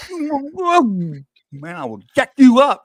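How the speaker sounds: high, loud mock threat to the mirror; hammed-up tough guy squaring up. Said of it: "And I was like".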